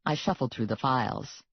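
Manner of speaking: ordinary delivery, nothing marked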